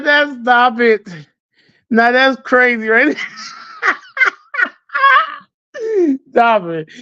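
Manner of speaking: high, squealing laughter through the words; cracking up. Retold or imitated. Reacting to a singing minister, cracking up with high squeals.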